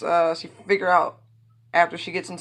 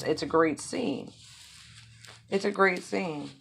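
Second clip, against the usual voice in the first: usual speaking voice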